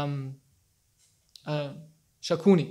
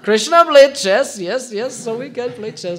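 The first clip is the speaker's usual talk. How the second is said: teasing mock excuse; 4 semitones higher, 4 dB louder than usual. Teasing the chess-loving listener with a mock justification. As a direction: tease the chess player's excuse.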